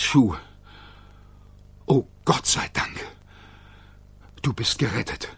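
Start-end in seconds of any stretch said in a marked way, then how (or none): 1.9-5.3 soft whispered relief; relieved whisper. A narrator's reading. Billie Jean relieved that Mac's shoe is saved, whispered. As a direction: breathe out the relief.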